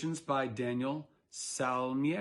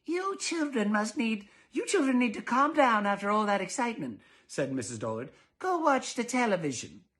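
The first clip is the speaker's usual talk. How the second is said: prim mother; 9 semitones higher, 4 dB louder than usual. Acted. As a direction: send the kids off primly. Missus Dullard instructing.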